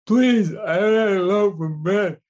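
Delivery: put-on high, loud slurred kid voice; kid with speech impediment. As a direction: beg for bread like the kid. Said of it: "he says".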